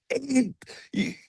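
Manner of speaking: put-on very high, drawn-out little-kid voice; squeaky imitation of a little brother. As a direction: mimic a small boy stammering out a taunt. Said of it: "my little brother was like".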